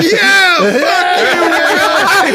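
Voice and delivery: high mock cheering jeer; imitated crowd roar. Ryder Cup crowd joining the heckle, high imitated roar. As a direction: pile on like a roaring crowd. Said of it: "tremendous roar from the crowd".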